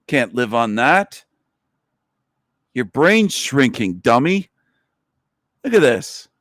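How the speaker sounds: usual speaking voice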